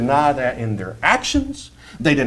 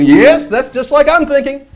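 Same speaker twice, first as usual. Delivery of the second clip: higher, louder mock delight; mock agreement; 10 semitones higher, 6 dB louder than usual. Preacher mocking verse-pickers, higher mock delight. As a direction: gush like a smug reader. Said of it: "they take another scripture and".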